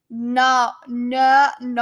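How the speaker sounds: trying out non-South African accents on "no"; attempted non-South African no. Shown as a demonstration. Streamer testing how others say no, non-South African accent attempt. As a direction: test each "no" in a foreign accent. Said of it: "how are you supposed to say no as a, as a no-, a non-South African".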